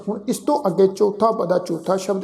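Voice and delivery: usual speaking voice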